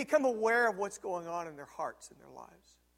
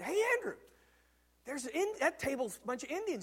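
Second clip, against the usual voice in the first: higher, eager calling to a friend; lively call-out; 6 semitones higher than usual. Retold or imitated. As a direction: call over to Andrew, keen to show off. Preacher quoting himself to friend.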